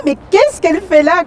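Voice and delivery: high, loud imitation of baffled onlookers; mock disbelief. Imite les candidates stupéfaites de la voir. (imitation aiguë et incrédule)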